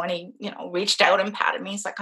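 ordinary delivery

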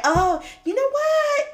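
bubbly high voice of newfound independence; excited resolve. Retold or imitated. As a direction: act out her eager decision. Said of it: "'Cause I was like".

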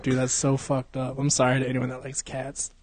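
usual speaking voice

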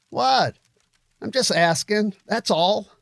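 high-pitched mock innocence; defensive protest. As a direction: protest that you meant no harm. Taunter defends himself when challenged.

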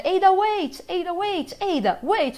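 repeated high call, easing down; imitated game catchphrase. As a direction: mimic the classic game line over and over. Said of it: "no jogo clássico ele fala".

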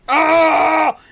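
high, loud drawn-out "ah"; champion's roar. Acted. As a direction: roar as you charge into the arena. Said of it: "he's like".

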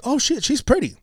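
wow, she's pretty; impressed. Retold or imitated. Smitten reaction in retold story.

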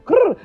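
high, loud mock growl; a sassy little growl; playful growl. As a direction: growl like a teasing cat. Growl after punchline.

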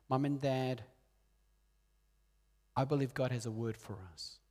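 ordinary talk, nothing marked

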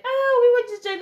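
high, loud mocking friends voice; shrill caricature of sheepish friends. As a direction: squeal their lame excuse back at them.

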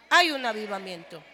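shouted Hay un avivamiento. Shown as a demonstration. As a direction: proclaim Hay un avivamiento with full force. Repeated revival declaration.

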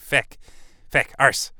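punchy mock Irish outburst; Irish accent. Shown as a demonstration. Podcaster with an accent stuck in his head, Irish. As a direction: throw out exclamations in an Irish accent. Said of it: "this frickin' Irish accent stuck in my head".

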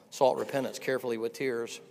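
ordinary talk, nothing marked

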